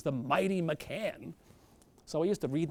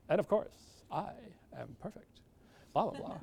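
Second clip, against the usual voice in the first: pompous self-parody; 4 semitones higher than usual. Retold or imitated. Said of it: "And I'm talking like this".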